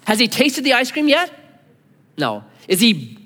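ordinary talk, nothing marked

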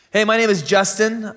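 ordinary talk

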